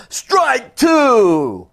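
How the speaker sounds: high, loud umpire call; booming strike two; umpire's shout. Acted. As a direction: call the strike for the whole stadium. Umpire calls the second strike.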